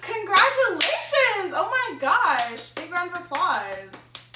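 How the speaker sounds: loud, slow, delighted congratulations; excited cheer. Real, not acted. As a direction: cheer them on, thrilled. Congratulating on anniversary.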